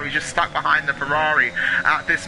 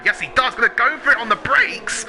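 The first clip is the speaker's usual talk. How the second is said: excited; 8 semitones higher, 3 dB louder than usual. Real, not acted. Live overtake call.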